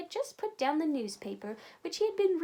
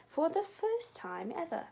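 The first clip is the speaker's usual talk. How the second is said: plain reading voice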